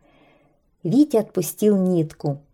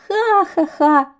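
high, slow mock laugh; birds laughing; 10 semitones higher, 4 dB louder, slower than usual. Birds laugh at the kite's silly face, high and slow. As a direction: laugh it out at him. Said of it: "смеялись птицы".

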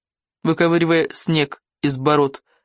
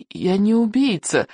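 higher, slow, timid protest; trembling mumble; 5 semitones higher than usual. A narrator's reading. Captured boy denying he is an assassin, trembling mumble. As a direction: falter through it in fear. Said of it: "промямлил Икинг дрожащим голосом".

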